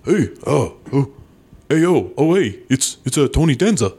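a big mock New York greeting; Tony Danza impression. Friends summon Tony Danza as a bit, hammed-up tough-guy voice. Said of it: "we can dial up Tony Danza".

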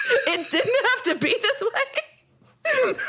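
laughing it out, slow and high; half-laughing, half-crying disbelief. Real, not acted. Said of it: "laughing through the line".

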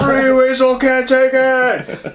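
high, slow, hammed-up wail; mock anguish. Attacker undone by Tree Weasel, wailing in mock agony. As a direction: cry out as if the words torture you. Victim driven mad by the phrase.

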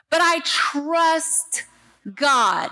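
slow drawn-out words, then a shouted GOD; slow build to a shouted God; slow emphatic declaration. Real, not acted. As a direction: lean hard into the final word. Preacher declaring trust.

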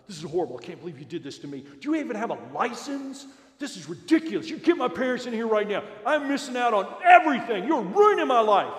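put-on loud, rising, high-pitched teenage tantrum; angry teen rant. Teen boy yelling at his counselor about losing his phone, furious shout.

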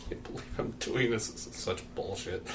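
soft, voiceless lion grumble; hushed acted complaint; disgusted muttering. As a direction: mutter it in resigned disbelief. Lion grumbles during the deal.

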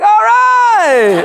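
mock whoop of surprise; excited relief. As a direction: burst out with relieved joy. Told he can walk after all.

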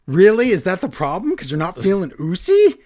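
high, loud incredulous questioning; mock incredulity. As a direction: act out a boss who can't believe the excuse. Acted boss mocking the complaint.

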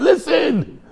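very high mock diva voice; playful high singer imitation. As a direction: sing it out high, like a pop star.

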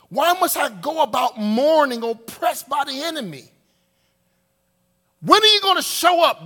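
loud, high cry of complaint to God; intense pleading. Acted. Preacher voices the psalmist questioning God, intense pleading. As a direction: build to a loud, high demand. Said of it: "that's how intense he's going".

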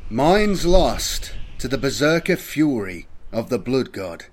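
ordinary delivery, nothing marked

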